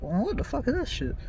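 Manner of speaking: puzzled truck driver. Retold or imitated. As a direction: imitate a baffled trucker under his breath. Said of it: "Truck driver's like".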